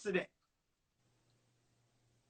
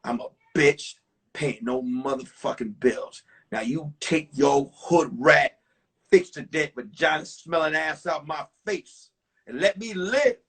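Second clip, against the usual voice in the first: defiant reply; 6 semitones lower, 3 dB louder than usual. Retold or imitated. Young man telling off his ex to his face, lower and defiant. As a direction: lay it on her, dismissive. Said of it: "I said".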